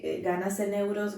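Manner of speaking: usual speaking voice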